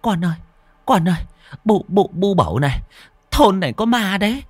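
high, panicked stammer; frightened stammering. A narrator's reading. Mother tells her daughters of a ghost, stammering in fright. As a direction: stumble over repeated words in a terrified, high-pitched voice. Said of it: "bà lắp bắp".

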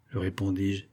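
plain reading voice

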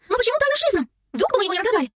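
put-on very high, incredulous girl; high-voiced bewildered protest; astonished questioning. A narrator's reading. Stunned girl keeps questioning.

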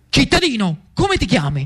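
high-pitched call to a citizen; fiery young Jacobin. Acted. Riccardo greeting young Lucio, fiery Jacobin hail. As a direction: hail them like a fiery revolutionary.

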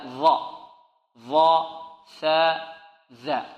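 ظاء، ثاء، ذا each set apart with the tongue between the teeth; tongue tip out. Shown as a demonstration. Teacher shows students where letters come from, tongue tip out. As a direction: interdental ظاء، ثاء، ذا, one at a time. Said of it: "لا بد من إبراز طرف اللسان".

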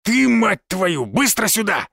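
high, angry thug's yell, drawn out at first; growled order. A narrator's reading. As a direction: order him over furiously. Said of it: "прорычал лысый". (злобно прорычать приказ)

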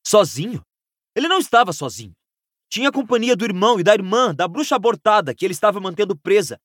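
mildly angry, rushed rebuttal; indignant retort. Acted. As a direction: throw it back, incredulous and heated. Harry angrily retorts in quarrel.

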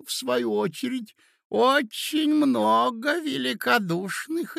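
put-on high, slow, reminiscing old man's voice; doddering old prince. A narrator's reading. Old prince recalling his Masonic lodge, doddering. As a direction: ramble slowly in a high pitch, pausing between phrases.

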